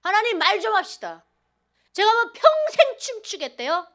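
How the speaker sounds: loud, high, indignant protest at God; retold angry outburst. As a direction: shout it up at God, half laughing in disbelief. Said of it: "하나님께 막 해악질을 했어요".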